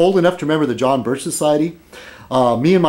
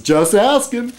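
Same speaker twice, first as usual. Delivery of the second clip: innocent shrug; 10 semitones higher, slower than usual. Acted. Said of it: "The guy says".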